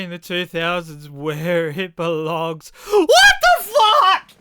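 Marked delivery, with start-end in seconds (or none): 2.9-4.3 high, loud, slow disbelieving shout; stunned WTF at a bizarre soda; shocked shout. Real, not acted. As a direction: yell it in total disbelief. Reacting to weird Pepsi flavor.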